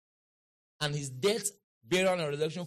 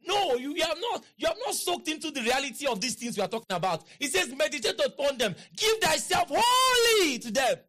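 urgent preaching, building; 8 semitones higher, 4 dB louder than usual. Real, not acted. Preacher correcting the congregation, urgent and building to a shout. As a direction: press them hard, then stretch WHOLLY high and loud.

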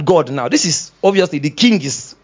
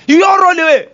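higher, louder hammed-up yelling; mock angry shout; 6 dB louder than usual. Pastor acting his own angry outburst, a mock shout. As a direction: blow up at them loudly.

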